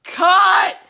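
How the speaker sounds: high, loud director's yell; yelled cut. Retold or imitated. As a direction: bellow it across the set. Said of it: "he yells".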